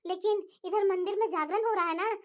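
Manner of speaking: normal talking voice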